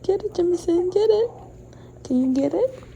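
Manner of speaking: loud, high, eager cheering on the dog; excited urging. Real, not acted. Owner urging her dog Jemison to grab the treat, excited loud cheer. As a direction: hype the dog up to snatch the treat.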